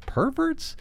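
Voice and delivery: perverts in a high, soft, rising disbelieving echo; incredulous repeat. Shown as a demonstration. Host mocking Trump's word choice with a high disbelieving echo. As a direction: echo perverts as if you can't believe it. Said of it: "The perverts".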